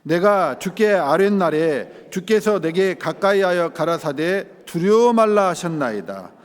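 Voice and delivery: slow, solemn scripture read. Shown as a demonstration. Preacher reading Lamentations aloud to the church, slow and solemn. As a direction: read the verse slowly and solemnly. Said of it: "한 절만 읽겠습니다".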